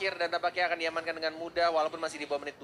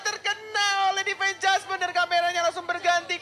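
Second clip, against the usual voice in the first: shouting; 11 semitones higher, 8 dB louder than usual. Real, not acted. Caster shouting at big play.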